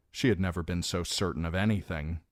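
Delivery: ordinary delivery, nothing marked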